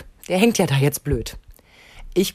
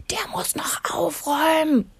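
put-on tattling kid; 11 semitones higher, slower than usual. Kind beschwert sich.

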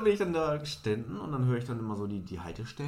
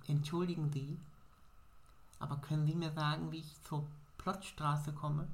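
higher, softer polite asking voice; lightly voiced timid stranger asking the way; a hint of a shy little woman; small woman asking; 3 semitones higher, 5 dB quieter than usual. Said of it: "spricht die mich an".

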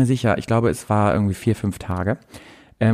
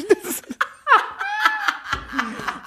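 high, helpless shrieking laugh; 4 dB quieter than usual. Real, not acted. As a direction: crack up completely. Helpless laugh at own joke.

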